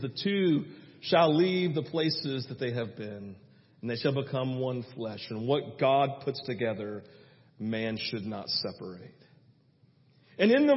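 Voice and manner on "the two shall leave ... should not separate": solemn scripture reading. Retold or imitated. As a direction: read it reverently, sinking lower and softer.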